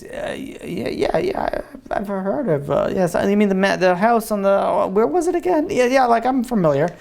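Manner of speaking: halting, evasive fumbling for words; nervous stammering. Acted. Guilty student asked about Sarah, stammering evasively. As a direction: start small, stammer, then overdo the casual yeah.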